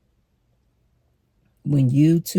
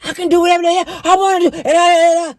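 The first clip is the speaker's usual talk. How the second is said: much higher, louder, fast mock yelling; mock screaming man; 13 semitones higher, 5 dB louder, faster than usual. Imitating a man yelling at her, high loud mock scream. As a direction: imitate a man shouting back. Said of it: "they start screaming like that".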